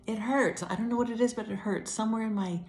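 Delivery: pained complaint. Retold or imitated. Re-enacting complaint at urgent care.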